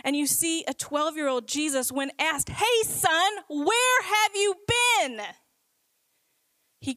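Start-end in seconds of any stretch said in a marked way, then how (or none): 2.5-5.2 higher, louder, slow anxious call; frantic parents. Acted. Mary and Joseph calling to their missing son, frantic and loud. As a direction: call out like a worried parent who lost their child. Parents finding missing twelve-year-old Jesus.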